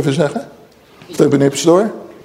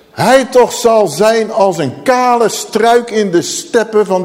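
slow, higher-pitched ringing curse; prophetic scripture declamation; 3 semitones higher, slower than usual. Acted. Preacher saying exactly what God says, solemn prophetic declamation. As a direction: draw out the words like a prophet.

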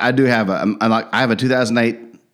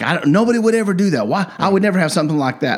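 higher, fast, imitating a panicked protest; mock freaked-out wife; 8 semitones higher, faster than usual. Husband imitates wife freaked out by listening devices, higher and fast. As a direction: play the wife freaking out, pitched up and rushed. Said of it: "She was like, totally".

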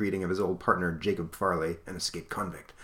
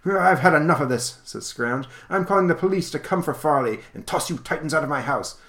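huffy miser; 6 semitones higher than usual. Acted. Miser threatens to call the police, high huffy bluster. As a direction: order them out in a high huff. Scrouge throws them out.

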